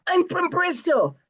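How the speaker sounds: high, thrilled squeal of a starstruck fan; excited squeal. Retold or imitated. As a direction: blurt it out, overjoyed to meet her idol. Thrilled fan meets her idol.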